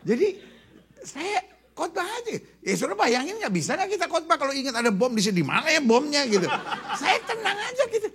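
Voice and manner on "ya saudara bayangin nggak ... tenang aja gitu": fast, loud, laughing sermon; excited preaching. Acted. Preacher telling the congregation about the bomb, excited. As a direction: rush it out for the crowd.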